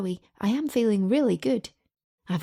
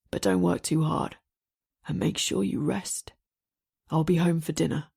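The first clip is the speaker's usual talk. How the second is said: deep male voice; 3 semitones lower than usual. A narrator's reading. Damien voiced, lower.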